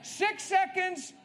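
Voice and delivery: loud, high rallying cry; hype call. Real, not acted. Preacher pumping up the congregation before prayer, loud and high. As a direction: fire up the crowd like a starter's gun.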